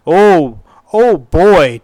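normal talking voice

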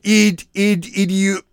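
high, halting sounding-out; struggling reader. Acted. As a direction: stumble over the word, drawing it out slowly. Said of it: "Tuco is having trouble reading".

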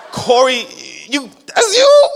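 high, loud, laughing disbelief; laughing surprise. Retold or imitated. Amazed dad laughing at his son, high and loud. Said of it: "I was like".

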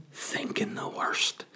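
hushed, slow whisper of dread. Real, not acted. Telling the story of coming home, a slow dread whisper. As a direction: breathe it out like a secret fear.

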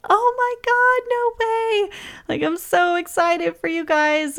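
high, excited squeal of joy; squealing cousin. Retold or imitated. Cousin squealing at the baby news, crying with joy.

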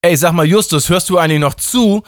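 high, loud, cheeky god voice; a brash young god poking his listener; god Justin. A narrator's reading. God Justin nags Justus for not listening, high and brash. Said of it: "fragte der Gott Justin".

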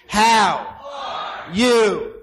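loud high call to the crowd; hyped crowd warm up. Acted. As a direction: pump up the audience.

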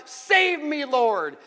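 calling out. A narrator's reading. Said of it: "And he yelled out".

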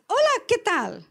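stranger's phone hello. Pretend. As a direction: lift the hello up like an unknown caller.

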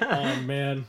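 ordinary talk

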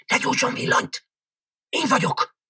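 tense, breath-heavy hushed order; hushed stage whisper; urgent whisper. A narrator's reading. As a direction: whisper it as a secret warning. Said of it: "suttogta Krámárc".

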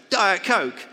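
loud, high barked demand like a spoiled rock star. Retold or imitated. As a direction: shout it like an entitled star wanting a drink. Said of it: "That's my [name] voice".

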